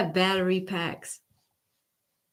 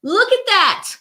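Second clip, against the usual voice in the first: much higher and louder, hyped up; excited; 13 semitones higher, 12 dB louder than usual. Real, not acted. Streamer hyping up the chat over Kornacki, excited. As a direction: cheer on the TV analyst with real excitement.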